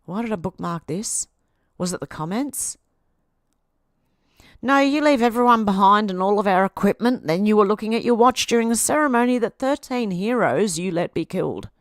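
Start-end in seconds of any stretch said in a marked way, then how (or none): 4.6-11.7 louder, heated reading of comment; angry reading. Retold or imitated.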